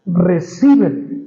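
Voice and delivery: "recíbele" said slowly and weighted, each syllable held; stress on recíbele. Shown as a demonstration. Preacher singling out the verb for his congregation, slow and stressed. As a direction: lean hard on "recíbele", drawn out. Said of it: "enfatizar solo en este verbo".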